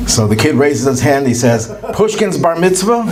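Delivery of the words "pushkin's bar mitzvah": high and giggling, can barely get it out; laughing through the punchline. Retold or imitated. Kid answers the teacher, high, breaking into laughter. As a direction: crack up while saying it.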